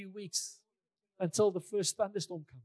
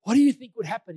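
loud, higher-pitched quoted question; imitating a preacher's insistent challenge; loud rhetorical challenge; 4 semitones higher, 11 dB louder than usual. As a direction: throw the question out to a crowd.

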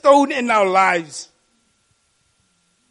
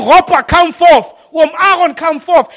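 calling out; 5 semitones higher, 10 dB louder than usual. Acted. Christ calling congregants by name.

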